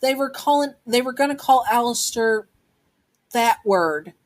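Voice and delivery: put-on high-pitched child explaining haltingly; hesitant acted child avoiding a word; reluctant explanation. A narrator's reading. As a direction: start high, restart, then drop on the avoided word. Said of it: "not wanting to say it yourself".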